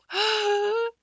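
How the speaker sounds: sharp loud gasp of surprise. A narrator's reading. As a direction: suck in breath in wonder. Anne gasps at the news.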